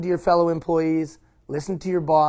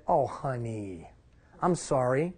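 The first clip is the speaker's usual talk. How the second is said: tender apology; 4 semitones lower, 7 dB quieter, slower than usual. Retold or imitated. Dad apologises to his daughter.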